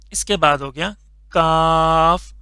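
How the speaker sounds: ordinary delivery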